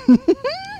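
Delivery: high-pitched laugh. Real, not acted. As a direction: laugh high after the punchline. Teller laughs at punchline.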